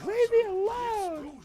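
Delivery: very high, drawn-out mock scream; piercing falsetto victim's scream. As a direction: shriek it slowly down the phone. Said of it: "she screams".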